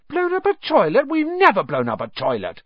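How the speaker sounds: high indignant fake innocence; mock innocence. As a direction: protest innocence mockingly. Twins feign innocence.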